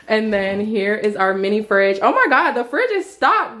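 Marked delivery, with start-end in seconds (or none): 2.0-3.6 excited. Real, not acted. Discovering stocked fridge.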